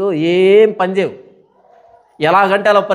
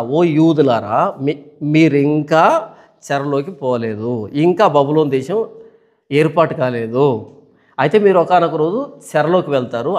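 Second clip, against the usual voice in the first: slow, low solemn proclamation; lower, slow declaiming of a prophecy; prophetic oracle; 4 semitones lower than usual. Acted.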